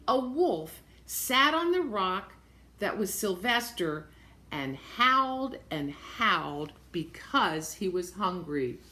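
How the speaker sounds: usual speaking voice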